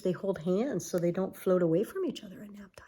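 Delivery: fast whispered aside. Real, not acted. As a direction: hush it quickly, like a secret. Aside about otters at bedtime.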